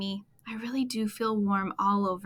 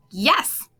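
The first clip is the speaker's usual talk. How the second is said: louder, eager frog's yell; shout; 7 dB louder than usual. Acted. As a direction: call it out happily. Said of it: "I shout".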